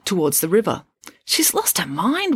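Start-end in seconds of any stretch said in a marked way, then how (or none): 1.2-2.4 worried. A narrator's reading. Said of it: "worried Melanie".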